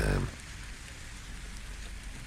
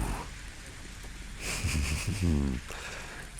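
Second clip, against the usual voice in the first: soft chuckle; 4 semitones higher, 6 dB quieter than usual. Acted. Boss offers employee a treat, soft chuckle. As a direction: chuckle softly to yourself.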